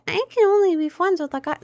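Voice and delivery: high mocking pick-me voice; pick-me girl. Mocking a pick-me girl to chat, high and prissy. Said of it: "in a pick-me way where it's like".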